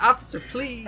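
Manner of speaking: high, hammed-up plea; mock pleading. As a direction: beg in comic pain. Retold plea while arm is twisted.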